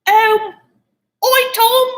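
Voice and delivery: high, loud mock Cockney call; Cockney sister impression. Podcaster mimicking the sister greeting Tom, high Cockney. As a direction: squeal it in a cartoon Cockney accent. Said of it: "her, like, Cockney accent".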